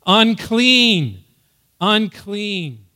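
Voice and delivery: loud, high warning cry; calling out. Acted. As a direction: call it out so passers-by keep away. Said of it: "call that out".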